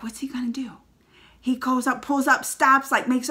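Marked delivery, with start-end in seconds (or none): 0.0-0.7 soft, whispered suspense; hushed suspense. Retold or imitated. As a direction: hold your breath and wonder. Suspenseful wondering.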